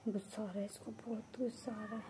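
hushed whisper. Real, not acted. Owner whispering beside the angry kitten. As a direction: whisper so the kitten stays calm.

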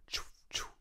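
soft whispered choo sounds; whispered footsteps. Retold or imitated. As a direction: breathe out each step softly. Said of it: "They make a soft sound".

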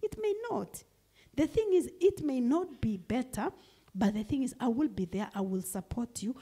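ordinary talk

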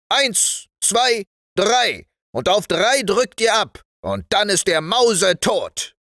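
high, loud drill commands; high-pitched parade-ground sergeant; commanding sergeant. Acted. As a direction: call out orders to the firing squad. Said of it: "sagte der dicke Wachtmeister".

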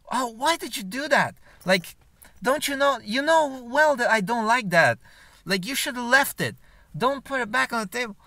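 imitating annoyed girlfriend. Girlfriend scolding her boyfriend over the handkerchiefs, high mock whine. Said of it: "she got pissed".

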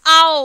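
ഔ loud, gliding like the "ow" in how; OW sound demo. Shown as a demonstration. Teacher answering which sound how takes, OW demo. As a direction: sound out ഔ on its own, ah into oo. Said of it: "ഇവിടെ ഔ എന്നും പറഞ്ഞിട്ടാണ്".

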